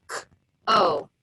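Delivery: "K-O" as a crisp kuh, then a separate oh; tapped-out sounds. Shown as a demonstration. Teacher tapping out sounds, "K-O" segmented into k and oh. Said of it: "Tap it out".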